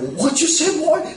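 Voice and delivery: a hammed-up street challenge, pitch climbing; mock angry challenge. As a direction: imitate an old man squaring up for a fight. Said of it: "He pulls up his sleeves and says".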